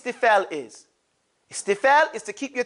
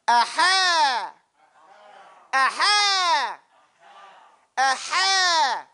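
corrected aha; 3 semitones higher, much slower than usual. Shown as a demonstration. Teacher corrects the class, a fuller exclaimed aha. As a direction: push the aha out fully. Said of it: "No, not aha".

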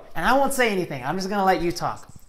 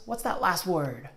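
normal talking voice

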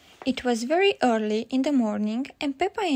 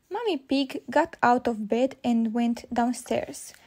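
ordinary delivery, nothing marked

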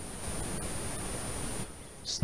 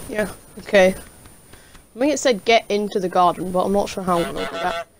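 ordinary delivery

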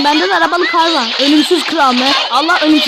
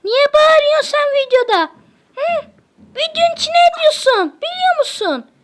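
much higher voice, a mock-cute telling-off; high-pitched scolding; 11 semitones higher than usual. Real, not acted. Scolding the noisy one on camera, high-pitched mock scold.

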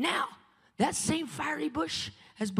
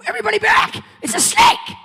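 higher, louder alarmed yell; shouted warning; 9 semitones higher, 11 dB louder than usual. Retold or imitated. Dreamer warning the crowd in the park, alarmed yell. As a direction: shout everyone back in panic. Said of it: "and I shouted".

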